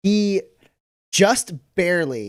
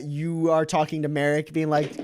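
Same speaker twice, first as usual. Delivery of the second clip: ordinary talk, nothing marked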